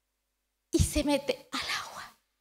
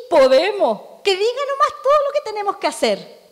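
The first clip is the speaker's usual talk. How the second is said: much higher and louder, eagerly promising; eager reply; 7 semitones higher, 9 dB louder than usual. Acted. Israel answering God at Sinai, loud eager promise. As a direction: shout it like a crowd that's sure it can.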